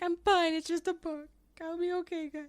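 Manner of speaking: high voice insisting all is fine; high mock reassurance. Teacher reassuring worried students, high mock brushing-off. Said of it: "And I'm like".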